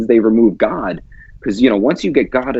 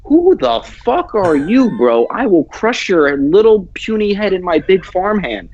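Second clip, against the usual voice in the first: high, slow, hammed-up tough guy; barbarian; 8 semitones higher, slower than usual. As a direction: bellow it like a brute farmer. Tough farmer answering mask demand.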